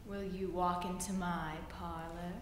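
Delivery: low, soft coaxing spider voice; sly spider. Acted. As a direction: purr it low and sweet like a spider. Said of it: "said the spider to the fly".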